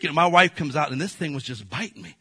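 ordinary talk, nothing marked

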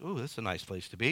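low, soft, quick murmur to oneself; hushed, pleased aside; drowsy contentment. Acted. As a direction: play someone quietly settling down for a nap. Acts out settling down to sleep.

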